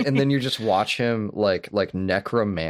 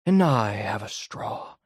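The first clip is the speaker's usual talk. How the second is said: hammed-up Daniel Plainview; 9 semitones higher than usual. Retelling the milkshake speech to his co-host, hammed-up and gloating. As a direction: savour each word like a gloating villain. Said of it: "He goes".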